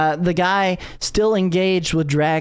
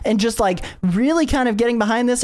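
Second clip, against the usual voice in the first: high, bubbly, rushing fangirl voice; gushing young fan heroine; 6 semitones higher than usual. Acted. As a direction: gush at your hero, pitched way up. GM voices gushing Kamala Khan.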